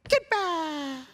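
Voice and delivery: high, hammed-up little voice of a purity ring; mock purity ring. A purity ring repelling a suitor, in a mock high voice. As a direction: shout it off the finger like a tiny guard.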